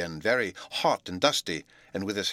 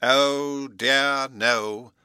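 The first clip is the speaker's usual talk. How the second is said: dismayed; 6 semitones higher, 8 dB louder, much slower than usual. A narrator's reading. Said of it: "The professor looked dismayed".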